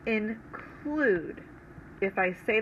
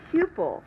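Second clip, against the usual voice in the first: pupil with PU higher and stressed; stress on the first syllable; 9 semitones higher, 3 dB louder than usual. Shown as a demonstration. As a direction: say pupil, lift PU. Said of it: "The first syllable is the accented".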